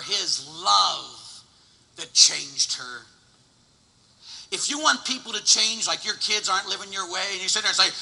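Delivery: normal talking voice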